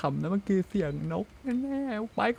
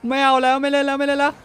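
louder, higher frightened pleading; panicked whine; 6 semitones higher, 7 dB louder than usual. Real, not acted. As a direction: beg to quit in a panic. Fleeing a monster in game.

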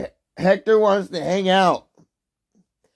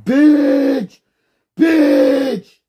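loud, much higher curses; furious shouts; 7 semitones higher, 5 dB louder, much slower than usual. Real, not acted. Streamer raging at chat, furious shouts. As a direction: explode on each word.

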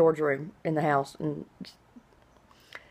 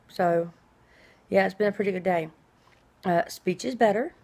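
ordinary talk

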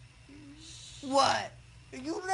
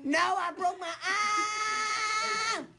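yelling; 6 semitones higher than usual. Real, not acted. Patient refusing baseball tomorrow, yelling. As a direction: yell it and stretch the final word. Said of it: "No more yelling".

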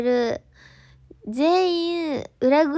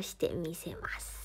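whispered ending; 7 semitones lower, 15 dB quieter than usual. Real, not acted. Trailing whisper on boast.

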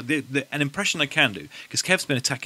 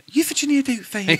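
put-on high old lady voice, pitched way up; Mrs Doubtfire impression; 12 semitones higher, 3 dB louder than usual. Friend doing Mrs Doubtfire for the podcast, high old-lady voice. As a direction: play a doting old nanny, high and sweet. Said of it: "Jake and I can do a".

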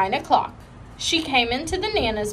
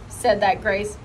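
plain reading voice

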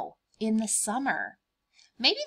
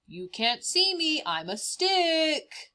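higher, very slow playful hush; sneaky stick pretense; 8 semitones higher, slower than usual. Acted. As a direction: tease the dog, sure it can't see you.

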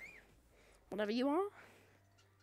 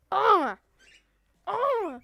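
normal talking voice

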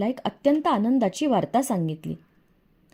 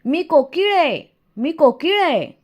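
higher, louder ringing announcement; proud proclaiming call; 7 semitones higher, 4 dB louder than usual. A narrator's reading. Cuckoo's song announcing itself to the other birds, proclaiming. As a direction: call it out to all, pitched up. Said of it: "पक्ष्यांना गरजून सांगतेच की".

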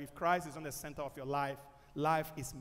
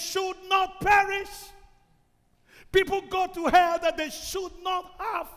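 higher, louder, slow cry; shouted warning; 12 dB louder than usual. Acted. Preacher crying out to the crowd, higher and louder.

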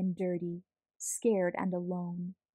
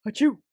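big loud acted achoo sneeze; 4 semitones higher, 8 dB louder than usual. Allergic neighbour near the kitten, loud sneeze. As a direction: let a loud sneeze burst out.

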